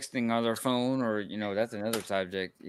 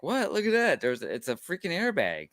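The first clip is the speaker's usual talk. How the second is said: amazed; 7 semitones higher than usual. Retold or imitated. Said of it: "I'm like".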